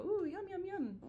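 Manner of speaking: high, sing-song relish; delighted husband. Retold or imitated. Husband smelling sauerkraut, high delighted relish. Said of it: "makes my husband go".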